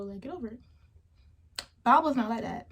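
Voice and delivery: ordinary delivery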